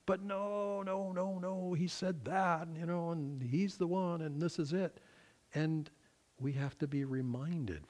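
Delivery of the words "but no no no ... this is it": high-pitched imitation of stubborn believers; put-on voice of someone fixated; mock insistent protest. Voicing people who are fixated.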